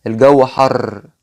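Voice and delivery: slow, the ر in حر rolled hard and long; heavy rolled r. Shown as a demonstration. Teacher demos the Egyptian rolled r, slow. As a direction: drag out حر with a strong trill. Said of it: "اسمعوا كده".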